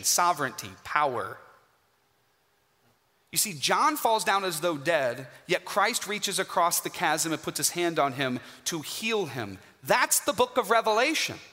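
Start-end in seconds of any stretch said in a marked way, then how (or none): none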